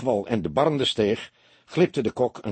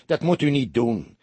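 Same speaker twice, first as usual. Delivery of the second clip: higher, more insistent admonition; half reproving; 4 semitones higher, 4 dB louder than usual. A narrator's reading. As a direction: press the reproof with raised pitch. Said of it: "zei hij half bestraffend". (half bestraffend, hoger en dringender)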